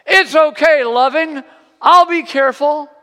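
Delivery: put-on high, loud boyish call; yelling back. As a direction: shout back cheerfully like a little kid. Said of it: "Jimmy yells back".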